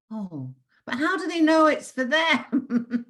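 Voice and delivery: put-on high, laughing little-girl question; a child's laughing why; insistent child. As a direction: plead like a small curious girl. Child self pressing mother.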